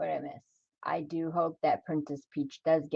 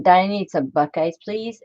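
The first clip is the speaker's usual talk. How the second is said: ordinary talk, nothing marked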